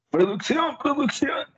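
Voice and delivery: high squeaky cartoon call for production; meme voice. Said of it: "quiero que pongas la foto de".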